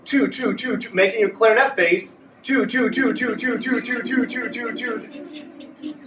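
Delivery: repeating two until the air runs out; fading as breath runs out; saying two until breath is gone; running out of breath. Shown as a demonstration. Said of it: "say the word two until you run out of breath".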